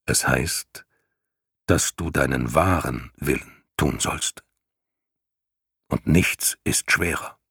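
low, grave rumbling lion voice; deep growling lion. Acted. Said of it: "mit jener tiefen, grollenden Stimme". (tiefe, grollende Löwenstimme)

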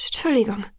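shivering stutter on the first sound; stammering. A narrator's reading. Freezing Bella apologizes to Jacob, stammering. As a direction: stammer out an apology through the cold. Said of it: "stotterte ich".

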